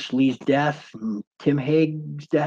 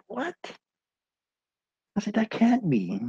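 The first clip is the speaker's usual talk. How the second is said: shocked; 11 semitones higher than usual. Retold or imitated. Told blood pressure is dangerous.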